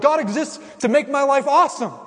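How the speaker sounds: high, loud, smug entitlement; mock entitled voice. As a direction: send up the spoiled believer. Mocking shallow cultural view.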